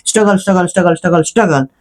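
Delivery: low, soft mock whine trailing into drone; mocking Tanya's whining. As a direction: mimic her endless sob story dismissively. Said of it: "तो तानिया वही अपनी बकलोली करती कि". (तानिया की नकल उतारते हुए धीमी, ऊबी हुई रिरियाहट)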